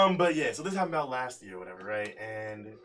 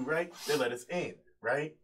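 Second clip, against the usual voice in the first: usual speaking voice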